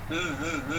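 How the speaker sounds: high, soft buzzing z like a bee; hummed zzz of a bee. Shown as a demonstration. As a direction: buzz the z like a bee. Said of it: "suara lebah".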